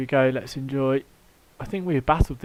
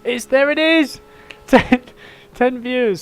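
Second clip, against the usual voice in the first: sudden high delighted burst; thrilled the count just ticked over; excited; 13 semitones higher, 11 dB louder than usual. Real, not acted. Excited to reach ten viewers.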